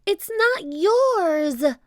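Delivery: high, loud, slow protest; indignant high-pitched holler; shouting. A narrator's reading. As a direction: yell it high and drawn-out in defiance. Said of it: "shouted Annie".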